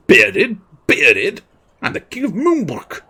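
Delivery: loud, rising, affronted royal outburst; indignant king. Acted. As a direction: sputter in offended royal disbelief. Insulted king retorts indignantly.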